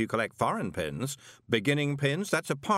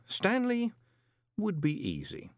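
savouring the name; 11 semitones higher than usual. A narrator's reading. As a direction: relish the name with a sly lilt.

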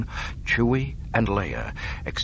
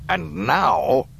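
sinister Emperor; 10 semitones higher, 5 dB louder than usual. Acted. Emperor gloating.

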